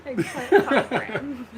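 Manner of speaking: slow and loud through laughter; laughing announcement. Pretend. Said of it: "You're gonna say it right now".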